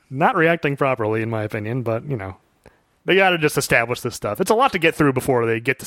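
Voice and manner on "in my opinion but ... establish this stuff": ordinary talk, nothing marked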